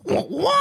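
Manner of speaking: high-pitched mock horn squeeze; imitated horn honk. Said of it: "with the horn".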